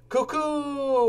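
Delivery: high, sung-out clock cuckoo; cuckoo clock call. Acted. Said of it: "went the clock".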